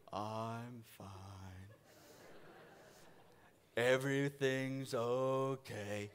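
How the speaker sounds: very low, very soft, slow Eeyore drone; droning gloomy donkey; Eeyore gloom. Acted. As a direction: mope it out like Eeyore. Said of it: "act more like Eeyore".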